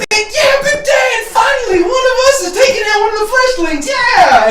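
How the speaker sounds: very high, fast excited squeal; cheering crowd. Acted. Crowd backing Big Dan, high excited cheering. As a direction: squeal for your champion. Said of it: "and they're like".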